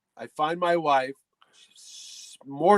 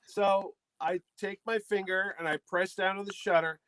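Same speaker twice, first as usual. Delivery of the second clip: ordinary talk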